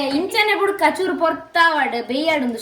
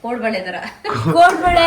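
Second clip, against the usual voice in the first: amused laughing; 5 dB louder than usual. Real, not acted. Joking about the food's shape.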